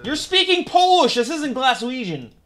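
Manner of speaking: high, loud yell of protest; loud, high-pitched objection; indignant outburst. Real, not acted. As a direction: yell in disbelief at the video. Protests at the wrong video.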